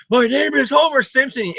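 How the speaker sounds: mock Homer Simpson voice; Homer impression. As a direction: growl it like Homer shaking Mr Burns. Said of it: "Homer is like".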